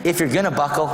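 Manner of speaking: ordinary talk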